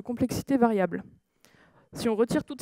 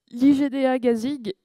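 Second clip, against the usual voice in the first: slow, louder letters in an English accent; 3 semitones higher, 6 dB louder than usual. Shown as a demonstration. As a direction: spell out the acronym with an English accent. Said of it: "je m'excuse d'avance pour mon superbe accent anglais". (avec un accent anglais, lent et appuyé)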